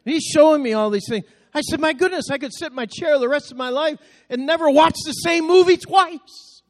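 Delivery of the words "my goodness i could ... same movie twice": higher, a touch louder in delight; amazed exclamation. Retold or imitated. Father amazed at all the shows his son set up, excited. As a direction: marvel at it like a kid with a new toy. Amazed at son's streaming setup.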